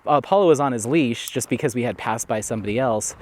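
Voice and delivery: ordinary delivery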